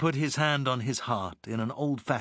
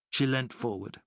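ordinary delivery, nothing marked

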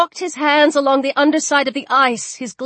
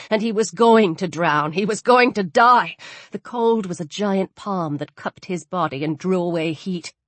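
ordinary delivery

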